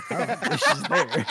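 high, slow squeal of laughter; laughing. Real, not acted. Friend cracks up at the joke, squealing laugh. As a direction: crack up mid-line.

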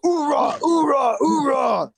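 high shouted marine cheer. Pretend. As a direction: bark out hoorahs like a marine.

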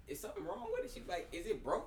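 high, puzzled mom voice; imitating puzzled mom. Son imitates his mama hearing the song, high and puzzled. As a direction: ask like a confused mother. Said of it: "she would be like".

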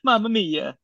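high, playful cartoon plumber catchphrase; Mario. Offering to be the next Mario, high cartoon impression. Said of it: "if you need me to be Super Mario".

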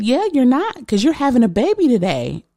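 high-pitched obstetrician impersonation; imitated doctor. Obstetrician breaking news, voiced.

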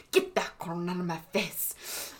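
slow, lightly voiced movie line; a light Nacho Libre impression, slowly; movie quote voice.

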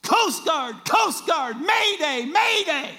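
high, loud, desperate radio call; screaming into the radio for the Coast Guard; retold mayday scream. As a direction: yell it like the boat is going under. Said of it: "he screams and he says".

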